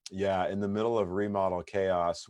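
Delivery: ordinary talk, nothing marked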